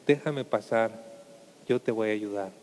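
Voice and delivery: low, soft and tender, as the Lord; deep gentle Lord. Acted. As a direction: speak low and soothing, offering help. The Lord asks to enter.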